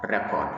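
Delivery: "record" as a noun, first syllable stressed, falling at the end. Shown as a demonstration. Teacher repeats the noun for learners, first-syllable stress on "record". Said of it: "as a noun, this word comes out as".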